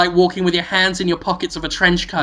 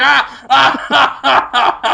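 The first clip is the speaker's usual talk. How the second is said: mock laughing gasps; 7 semitones higher, 4 dB louder than usual. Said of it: "You say".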